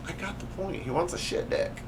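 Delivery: hushed, nearly voiceless whisper; breathy whispered deadpan aside. Real, not acted. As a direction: mutter it under your breath. Quiet aside after dad text.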